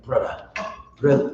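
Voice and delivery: softly repeated "brother" in a faked foreign accent that comes out African; mock Elamite accent. Campers pestering him to leave, hint of a foreign accent. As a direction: lightly imitate their pleading call. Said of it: "why I sound like an African?".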